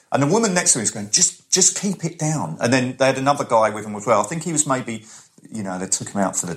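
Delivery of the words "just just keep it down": shushing companion. Retold or imitated. Imitates woman shushing her companion.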